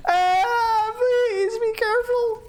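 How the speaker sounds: squeaky high pleading, slowed right down; strained falsetto plea to a teammate; high anxious plea. Real, not acted. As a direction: beg them to be careful in a tiny high voice.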